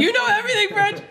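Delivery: high, laughing tease; playful, laughing mock accusation; teasing exclamation. Real, not acted. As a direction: exclaim it through laughter, pitch way up. Teasing the French guest, laughing.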